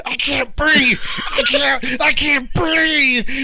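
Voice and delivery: high, rising panicked cries; shouting he can't breathe. Retold or imitated. Panicking recruit in gas.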